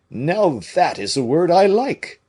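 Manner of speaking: hearty approval; pleased Porthos. A narrator's reading.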